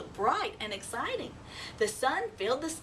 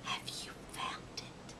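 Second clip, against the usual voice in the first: whisper; 13 dB quieter than usual. Acted. Owlet whispering her worry to the mouse. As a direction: whisper it like a lost secret. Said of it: "whispered Ola".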